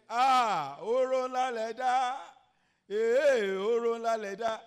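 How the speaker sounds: high, very loud, drawn-out wail; acted crying out. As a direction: wail like a woman being buried alive. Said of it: "And she's crying".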